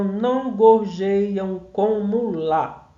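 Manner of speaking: "não gorjeiam como lá" slow, syllable by syllable; metrical scanning. Shown as a demonstration. Teacher closing the perfect verse, slowly measured syllables up to LÁ. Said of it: "porque a quantidade de sílaba".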